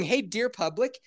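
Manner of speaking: ordinary talk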